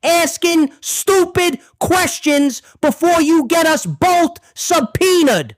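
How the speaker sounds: high, slow, emphatic scolding; exasperated order. Acted. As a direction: shut the questioning down word by word. Angrily shutting down the questions.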